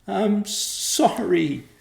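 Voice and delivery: high, loud, slow wail. A narrator's reading. Rachel on her knees wailing to Joe. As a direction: wail the apology in tears. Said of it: "she wailed".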